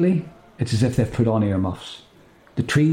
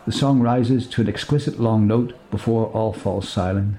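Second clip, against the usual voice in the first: usual speaking voice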